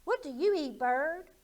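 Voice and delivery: high, loud frog question; nosy frog asking; bouncy high frog voice; curious frog. Acted. Frog asks the bird.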